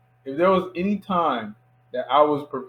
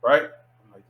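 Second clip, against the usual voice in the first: usual speaking voice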